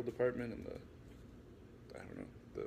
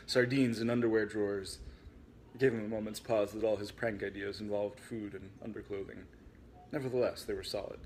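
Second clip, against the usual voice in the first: ordinary delivery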